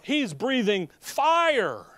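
usual speaking voice

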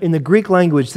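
ordinary talk

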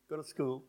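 "school" with oo fronted toward ee; young people's pronunciation. Shown as a demonstration. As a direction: say "school" with little lip rounding. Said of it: "young people today".

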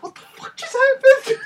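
high, loud shocked cry; shocked shriek. Retold or imitated. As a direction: yell it like you can't believe it. Reliving shocking episode ending.

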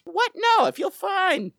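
high, stretched mock disbelief; startled patient. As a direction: protest like a stunned patient. Acts the oblivious patient to doctor.

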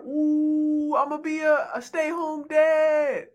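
mock gushing, high and loud, stretched slow at the end; hammed-up would-be house husband; eager male groupie. Male groupie dreaming of marrying a player, mock gushing.

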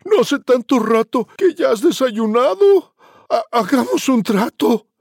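chillido agudo y fuerte de cerdito; frightened piglet shout. Acted. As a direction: grita con pánico de cerdito. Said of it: "El cerdo gritó". (cerdito asustado grita al lobo con voz aguda y fuerte)

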